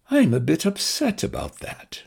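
high plummy nasal understatement; Arthur voice. Acted. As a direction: mildly put out English gentleman.

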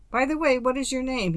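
high, offhand tossed-off question; careless. A narrator's reading. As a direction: ask it casually over the shoulder. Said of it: "he asked carelessly".

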